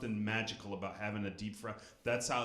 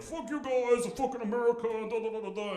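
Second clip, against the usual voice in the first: high, loud sneering French voice; mock French; 12 semitones higher, 7 dB louder than usual. Mocking the French reaction to the war, in a high sneering voice. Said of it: "the French were all like".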